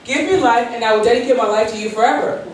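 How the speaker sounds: ordinary talk, nothing marked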